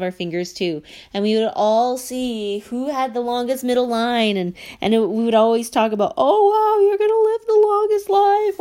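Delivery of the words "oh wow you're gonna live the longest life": much higher, louder squeal of amazement; excited relative. Retold or imitated. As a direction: gush like an aunt spotting the longest line. Relatives comparing palm lines.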